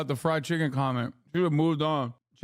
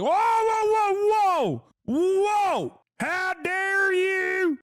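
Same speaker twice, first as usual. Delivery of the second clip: mock outraged shout; 4 dB louder, much slower than usual. Mocking a caught Nazi reacting.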